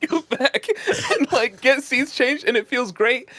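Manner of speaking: laughing. Retold or imitated. Laughing retelling seat change.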